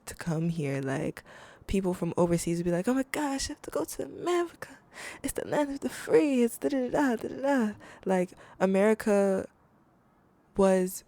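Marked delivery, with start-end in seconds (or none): 2.9-7.7 high-pitched, mock-dreamy gushing; starry-eyed imitation. Host mimics people from overseas longing for America, high mock-excited gushing. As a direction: gush in a high put-on voice like an awed outsider.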